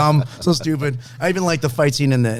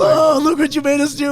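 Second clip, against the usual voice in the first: mock whiny voice; 12 semitones higher, 3 dB louder than usual. Quoting movie character.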